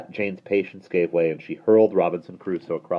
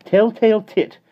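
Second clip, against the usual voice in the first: high, loud mocking chant; nanny jeering at a telltale; 14 semitones higher, 8 dB louder than usual. A narrator's reading. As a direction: taunt him. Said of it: "jeered Mary Poppins".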